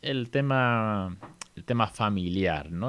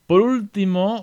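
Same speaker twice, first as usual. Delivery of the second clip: emphatic opener; 11 semitones higher, 7 dB louder, slower than usual. Real, not acted. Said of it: "lesson section opener".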